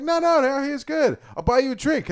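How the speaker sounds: high coaxing stranger voice; high coaxing imitation of the stranger; stranger insisting.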